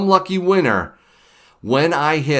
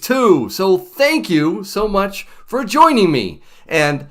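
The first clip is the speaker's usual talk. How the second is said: show intro; 6 semitones higher, 5 dB louder than usual. Acted. Opening the show over music.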